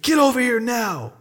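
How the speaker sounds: high-pitched parental command; stern retold yell. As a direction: summon the child sharply, re-enacting the scare. Father re-enacts yelling at his child.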